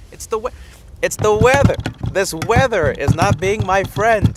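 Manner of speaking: loud, high, exasperated shout at the weather; frustrated outburst. Real, not acted. Complaining about weather ruining show.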